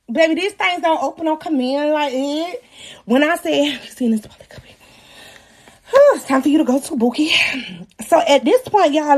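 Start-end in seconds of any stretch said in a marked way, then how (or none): 3.7-4.7 hushed whisper; whispered aside. Retold or imitated. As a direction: whisper it under your breath.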